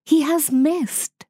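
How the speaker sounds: a mother wolf calmly noting the miss. A narrator's reading. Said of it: "said Mother Wolf".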